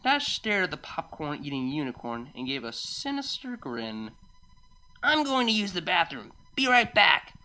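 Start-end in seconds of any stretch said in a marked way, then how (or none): 5.0-7.3 higher, breezy announcement; abrupt excuse. Acted. Rainbow Dash excusing herself to her friend, announcing. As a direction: call it out higher, louder at the end.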